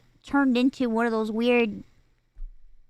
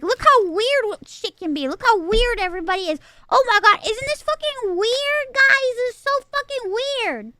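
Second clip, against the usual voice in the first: much higher, mock-gushing voice; mocking the movie; 9 semitones higher than usual. Reviewer imitating the movie showing off, higher mock gushing. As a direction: parody a film trying too hard to be weird. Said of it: "trying very hard to be like".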